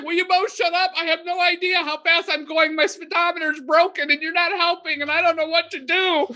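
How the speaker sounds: high, exasperated imitated driver; a hint of a stressed-out driver pleading; frantic driver. Frantic driver snapping at both.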